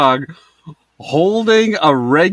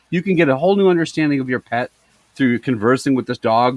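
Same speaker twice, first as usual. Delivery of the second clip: ordinary delivery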